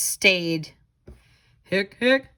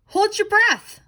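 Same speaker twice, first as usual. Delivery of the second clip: lightly voiced ghost, higher and a touch louder; ghost giving advice; 9 semitones higher, 4 dB louder than usual. Ghost tells Skeleton how to stop hiccups, lightly voiced. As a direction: offer it like a helpful little ghost. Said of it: "Ghost told Skeleton".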